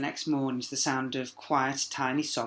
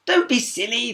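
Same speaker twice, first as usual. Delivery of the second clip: higher, louder princess; princess calling back; 10 semitones higher, 9 dB louder than usual. A narrator's reading. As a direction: toss it over the shoulder. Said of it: "the princess called back".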